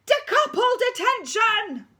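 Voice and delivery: high, loud drawn-out bellow; deputy head screaming the punishment; furious shout. Acted. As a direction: roar detention at the school.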